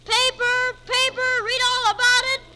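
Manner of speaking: high, loud newsboy street cry; hawking papers. Acted. Boy selling papers calls out loudly to the street. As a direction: call out to passers-by like a newsboy.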